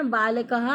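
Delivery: बालकः ending in a clearly voiced "ha"; visarga demostrada. Shown as a demonstration. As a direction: let the final ः of बालकः sound as an added "ha". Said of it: "ह की आवाज़ देता है".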